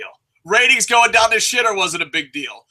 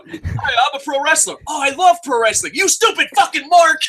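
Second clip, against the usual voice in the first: skit wrestler insult; 8 semitones higher than usual. Acted. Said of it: "reenact my skit".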